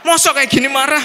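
high, loud indignant retort; angry protest. Retold or imitated. In-law repeating the protest, loud and high. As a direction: repeat it, still offended. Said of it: "Nah itu marah gitu".

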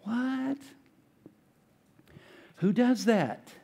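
high, hushed "what?", then a pause; incredulous. Acted. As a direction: marvel in disbelief at such kindness. Preacher marvels at Joseph's response.

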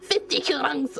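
badly faked angry German; mock angry German. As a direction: play at being a furious, slightly drunk German. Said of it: "I sound like a drunk German".

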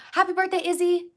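high, loud happy birthday; excited birthday shout. Real, not acted. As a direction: cheer it out bright and high.

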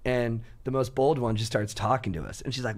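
ordinary delivery

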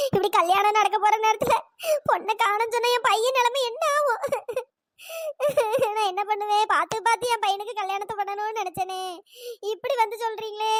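sobbing lament between phrases; crying. Acted. Groom's mother weeps over her son's ruined wedding, crying. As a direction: wail in distress, sobs breaking in. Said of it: "கொஞ்சம் அழுவாதம்மா".

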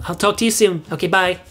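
ordinary delivery

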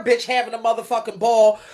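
usual speaking voice

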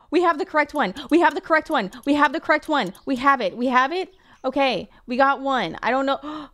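loud, high, triumphant chant; excited cheering. Real, not acted. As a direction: celebrate the find out loud. Finally found the right screw.

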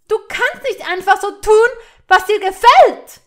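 furious high shout; about to burst. A narrator's reading. Hermine provoked by Malfoy's boredom, bursting with fury. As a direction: yell at him at full force, exploding with anger. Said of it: "brachte Hermine fast zum Platzen".

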